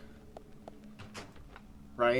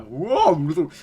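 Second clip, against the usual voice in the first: surprised; 4 semitones higher, 10 dB louder than usual. Real, not acted. Jaw-dropping reaction to woman.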